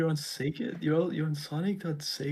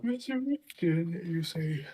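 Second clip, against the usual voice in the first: higher, slow mock-dramatic question; theatrical intrigued voice; 5 semitones higher than usual. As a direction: play the intrigued villain, drawn out.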